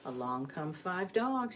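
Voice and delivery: normal talking voice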